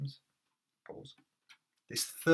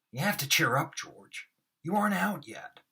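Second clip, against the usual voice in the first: higher, louder hammed encouragement; overacted Moss; 6 semitones higher, 6 dB louder than usual. Shown as a demonstration. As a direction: push it big and theatrical. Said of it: "I really overact them".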